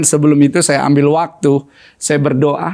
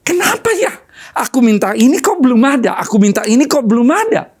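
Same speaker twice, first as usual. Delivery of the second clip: mock whine; 7 semitones higher than usual. Mimics a complaining child of God.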